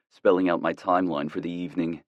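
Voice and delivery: plain reading voice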